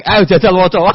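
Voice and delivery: high, loud command; grave angel's order. Acted. Mungkar Nakir ordering Umar to read, a high loud command. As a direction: bark the order upward.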